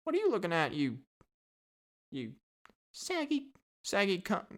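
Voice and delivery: high, halting parrot voice; British parrot. Acted. British parrot insulting visitors, high squawky voice. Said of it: "they were probably just like".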